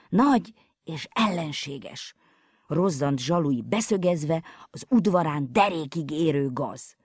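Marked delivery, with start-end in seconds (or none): none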